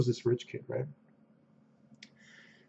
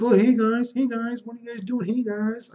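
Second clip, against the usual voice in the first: Josh; 9 semitones higher than usual. Retold or imitated. Player walks in on the team, high-pitched mocking impression. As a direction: imitate an over-eager tagalong in a high pitch. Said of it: "I think that's how he's like".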